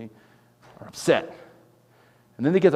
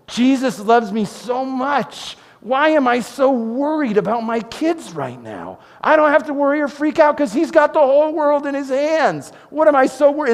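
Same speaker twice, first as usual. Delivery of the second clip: worried man's inner voice; 11 semitones higher, 4 dB louder than usual. Retold or imitated. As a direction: ease into it like remembering he's loved. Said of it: "he's gonna remember".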